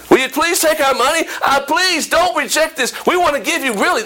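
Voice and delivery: high, insistent acted pleading; begging. Church members urge the preacher to accept their gift, begging. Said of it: "begging the pastor".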